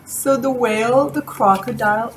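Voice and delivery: ordinary talk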